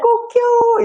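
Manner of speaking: very high cock-a-doodle-doo; high imitated cockcrow; rooster crow. Shown as a demonstration. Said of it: "수탉 소리 한 번만 내주세요".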